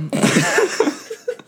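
high laughing fit bursting out; real uncontrollable giggles; helpless laughter. Real, not acted. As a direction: lose it completely after trying to compose yourself. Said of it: "you laughed for, like, five minutes".

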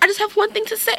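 put-on high, prim little girl voice. A chatty girl interrupting the Zoom class, high and prim. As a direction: pipe up like an eager kid.

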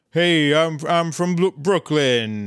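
imitating a hammy Brooklyn accent; bad Brooklyn accent. Host imitates Annie's audition, bad Brooklyn accent. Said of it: "it's a bad, real bad, uh, sort of accent".

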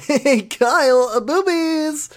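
high, slow silly dude voice; squeaky mocking voice; goofy character voice.

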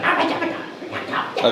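high breathy goblin squeal; excited goblin. Acted. Goblin voiced by GM.

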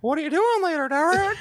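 hammed-up creepy line to a friend; high playful creepy tease; creepy come-on. Creepy voice teasing friend Derek.

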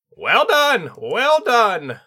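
high, loud, sharp tengu cry; piercing bird spirit praising his student. A narrator's reading. Sensei praising his student in a sharp, piercing voice. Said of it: "came a sharp, piercing voice".

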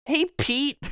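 high-pitched call for attention; mock chiding. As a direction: hail him like a disappointed coach. Mock coaching of the villain.

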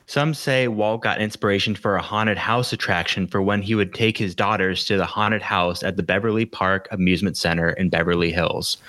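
ordinary delivery

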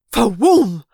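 loud booming whoosh; blast-off fa-woom. A narrator's reading. Narrator voicing the ship suddenly accelerating, loud boom. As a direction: burst it out like engines kicking in.

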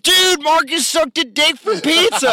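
high, loud, slow mock friend voice. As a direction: ham up the teasing drunk buddy. Mocking drunk friends quoted.

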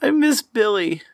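put-on high child voice; sad little kid. Child telling mom what's wrong, high sad kid voice. Player acts the crying child.

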